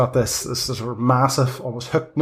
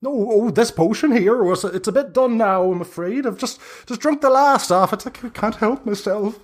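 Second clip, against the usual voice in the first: high crone admitting she drank it all; a witch confessing with a guilty titter; sheepish old hag; 9 semitones higher than usual. Acted. GM voices the hag.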